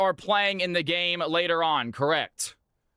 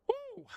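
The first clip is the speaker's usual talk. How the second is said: high, long whooping cheer; excited whoop; 10 dB quieter than usual. Real, not acted. Streamer cheering while dancing, high whoop. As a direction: let out a joyful woo. Cheer before starting games.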